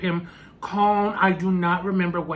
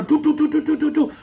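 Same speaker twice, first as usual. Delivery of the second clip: imitated pounding; 10 semitones higher, 5 dB louder than usual. Imitating banging on the door.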